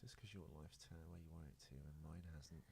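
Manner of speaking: low, soft mock snipe; muttered retort. [name] retorting to begging actor, quiet. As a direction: imitate snapping back under the breath. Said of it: "And I was like".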